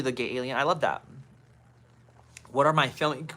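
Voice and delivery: usual speaking voice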